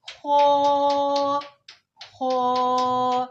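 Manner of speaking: "खो" said thick and full from the throat, loud; heavy kho. Shown as a demonstration. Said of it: "खो को मोटा करके पढ़ना है". ("खो" मोटा और पुर करके)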